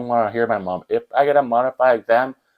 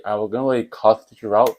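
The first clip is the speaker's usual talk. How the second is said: ordinary talk, nothing marked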